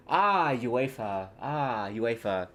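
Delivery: slow, contented sigh of "Ah, uefa"; savouring. Shown as a demonstration. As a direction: relish the word like a treat. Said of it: "It just feels nice saying uefa".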